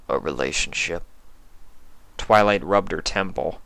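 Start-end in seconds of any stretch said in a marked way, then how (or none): 0.1-1.0 lower, soft, blank question; puzzled stranger. Acted. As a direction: echo the word without getting it. Sympathy voiced low.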